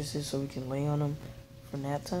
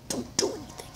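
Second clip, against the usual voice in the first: unvoiced, whispered higher warning; mouthed warning; 12 semitones higher, 4 dB quieter than usual. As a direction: mouth it silently like a secret signal. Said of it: "he was giving me signals".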